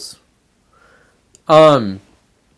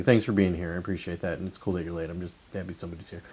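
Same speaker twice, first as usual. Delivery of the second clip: quiet aside; 7 semitones lower, 11 dB quieter, faster than usual. Real, not acted. Aside to late viewer.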